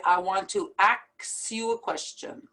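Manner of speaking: "ask" said as "aks", K before S; swapped-letter mispronunciation. Retold or imitated. Teacher quoting what some people say, mispronounced. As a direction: flip the S and K in "ask". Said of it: "the K and the S".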